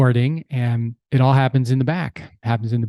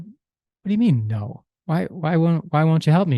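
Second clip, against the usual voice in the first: retold indignant surprise; 4 semitones higher than usual. Husband asking his wife why she refuses, in mock disbelief. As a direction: act hurt that she won't help, half playing.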